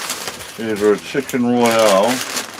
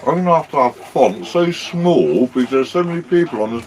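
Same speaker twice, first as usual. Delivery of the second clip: higher, playful dog talk; pet talk voice; 4 semitones higher than usual. Real, not acted. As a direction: tease the naughty dog.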